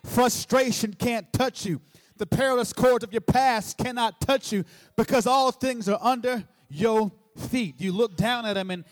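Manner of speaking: loud, high-pitched preaching cry, far above it all; full force preaching. Acted. Preacher to congregation, loud triumphant preaching. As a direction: shout triumph over the past to the church.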